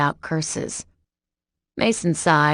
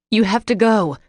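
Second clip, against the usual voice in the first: higher, louder urgent plea; 5 semitones higher, 6 dB louder than usual. A narrator's reading. Sam urging Mason to flee, loud urgent plea. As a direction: push him to leave now.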